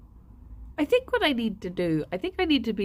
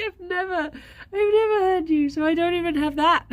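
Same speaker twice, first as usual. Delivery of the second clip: gushing quote; 11 semitones higher, 4 dB louder than usual. Retold or imitated. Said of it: "I was like".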